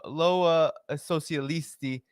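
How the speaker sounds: ordinary talk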